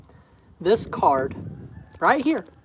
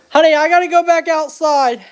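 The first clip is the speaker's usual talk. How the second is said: calling out; 5 semitones higher, 6 dB louder than usual. Real, not acted. Calling to wife from hallway.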